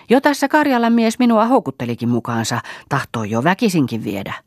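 very high, loud provoking boast; taunting. Acted. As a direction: throw the boast in her face. Marja taunts mother-in-law. (ilkeä kerskaus)

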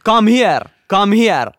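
high, loud shouted command; retold shout. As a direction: imitate a man yelling someone over. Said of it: "ja se huusi".

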